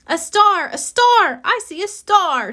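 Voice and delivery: high, loud, thrilled cry; excited shout. A narrator's reading. Sammy in the play, spotting the star, excited high cry. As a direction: point up and shout it with joy. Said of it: "Sammy said clearly".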